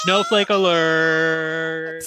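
slow, loud announcer alarm call; shouted alert. Acted. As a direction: blare it out like a siren warning. Host announces snowflake alarm.

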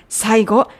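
ordinary talk, nothing marked

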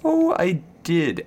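usual speaking voice

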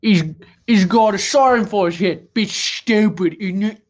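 hammed-up London accent, slow; mock London geezer, slow; mock British accent. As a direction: put on a cockney tough-guy voice. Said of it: "British people that say bruv".

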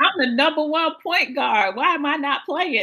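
high, loud mock complaint of a benched star; mock frustrated athlete. Host mimicking the benched player's indignant whine.

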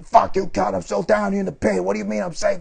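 high, raging shriek from the pit; imitating a desperate captive cursing; mock screaming victim. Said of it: "the girl just screams at her".

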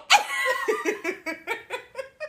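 high mock shriek; attempted screech. Shown as a demonstration. Co-host trying the creature noise, high mock screech. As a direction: try a very high, drawn-out squeal. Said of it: "let me try it".

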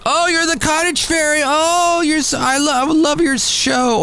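very high, squealing mock fan voice; exaggerated high-pitched gushing. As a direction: play a starstruck fan tripping over the words. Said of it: "people would be like".

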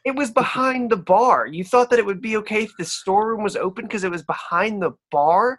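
high, disbelieving questioning; mock his logic in a high voice; incredulous barmaid. Barmaid to the player's excuse, high and incredulous.

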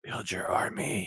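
slow eerie whisper. Acted. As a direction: whisper it like a creepy taunt. Teasing whisper at guest.

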